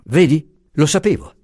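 heated, high-pitched refusal; flaring up. A narrator's reading. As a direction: catch fire and refuse outright. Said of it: "pigliando fuoco".

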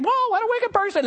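high hammed-up whoa; mock horror. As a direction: echo the disgust back comically. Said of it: "And you're thinking".

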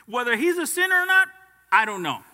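high, loud, slow defiant declaration; defiant blind man. Acted. Blind man defying the Pharisees, loud and slow. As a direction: fling it back at the accusers.

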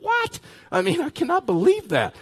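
high-pitched indignant disbelief; acted-out shocked reaction; incredulous outburst. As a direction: squeal it in mock outrage, pitch way up. Reacting to being hung up on.

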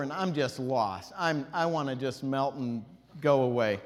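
usual speaking voice

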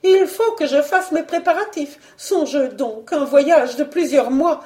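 high, insistent wife; excitable high-pitched baroness; agitated baroness. Acted. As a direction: justify yourself in a high, fussy voice. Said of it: "La baronne reprenait".

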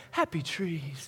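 light impression of a gentle TV painter; soft, drawn-out painter's voice, lightly imitated; Bob Ross. Preacher imitating a TV painter at work, lightly soft impression. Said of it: "if you've ever seen Bob Ross".

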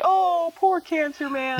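mock pity.

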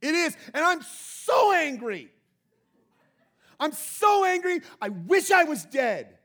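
preacher voicing Jonah's melodramatic rage at God; hammed-up angry Jonah. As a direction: throw a theatrical tantrum as Jonah. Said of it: "He answered".

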